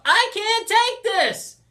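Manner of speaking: high, drawn-out full-force yell. A narrator's reading. Student cracking as the pressure mounts, yelling out. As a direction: crack under pressure and scream it slowly. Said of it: "Ryder would yell out".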